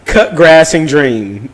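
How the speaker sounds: ordinary talk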